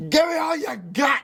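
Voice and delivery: cartoonish high-pitched movie outburst; Al Pacino. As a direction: cry it out like a bad actor impression. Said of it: "I gotta give it to Pacino".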